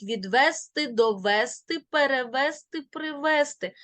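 відвести, довести, перевести mis-stressed on вес, rising list; quick list with вес stressed in відвести, привести; wrong stress on вес. Shown as a demonstration. Teacher warning against wrong forms, stress on вес. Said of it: "ні в якому разі не кажіть".

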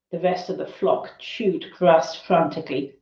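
ordinary delivery, nothing marked

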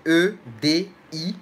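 slow, separated letters; careful letter-by-letter dictation; spelling out. Shown as a demonstration. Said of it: "tu mets pas d'espace au milieu".

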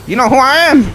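mock outraged villain. Killer taunting a survivor.

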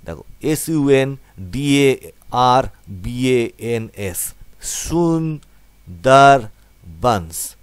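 S U N D A R B A N S spelled letter by letter, then সুন্দরবনস said slowly; spelling out letters. Shown as a demonstration. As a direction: break the word into letters, then join. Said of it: "এইভাবে ভেঙে ভেঙে উচ্চারণ করবে". (অক্ষর ভেঙে ভেঙে উচ্চারণ)

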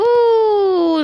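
high loud cheer of joy; excited whoop. Real, not acted. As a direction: whoop it out happily. Gamer cheering on arrival.

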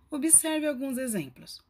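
ordinary talk

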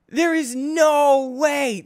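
high, slow, stretched disbelief; stunned and wailing; disbelieving cry. Real, not acted. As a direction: drag out the disbelief. Still disbelieving.

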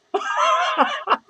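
long high cackle. Real, not acted. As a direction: crack up into shrieky laughter. Laughs after claiming to be dying.

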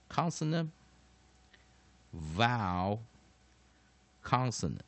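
consonant, vowel, consonant spaced out with long pauses; word by word. Shown as a demonstration. Teacher showing students the pattern, consonant, vowel, consonant word by word.

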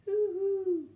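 loud, high owl hoots; big ringing owl call; owl hooting. A narrator's reading. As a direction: hoot long and loud like an owl. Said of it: "hoots the owl".

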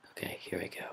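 whispered psych-up; hushed focus. Real, not acted. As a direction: whisper to yourself before the jump. Psyching up before attempt.

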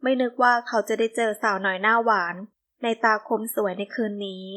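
ordinary delivery, nothing marked

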